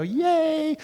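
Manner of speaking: high, gleeful yay; kid's yay. Retold or imitated. A little kid riding through puddles, gleeful and high. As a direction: cheer like a kid hitting a puddle.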